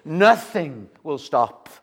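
usual speaking voice

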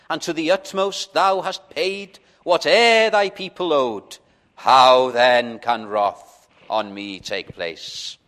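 put-on measured reading of an old hymn verse; reciting a hymn to the congregation; hymn recitation. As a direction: recite the verse with reverent weight.

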